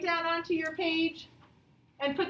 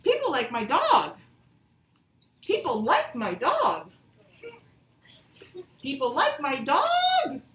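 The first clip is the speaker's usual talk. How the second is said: louder, lively and sing-song; the right way, full of excitement; 4 dB louder, slower than usual. Shown as a demonstration. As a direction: read it like you're thrilled. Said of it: "I would say it with some excitement".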